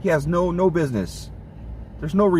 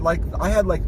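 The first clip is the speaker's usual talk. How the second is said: ordinary delivery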